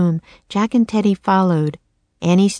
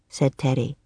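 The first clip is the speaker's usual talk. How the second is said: ordinary delivery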